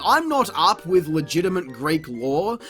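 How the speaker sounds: usual speaking voice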